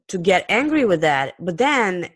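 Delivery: usual speaking voice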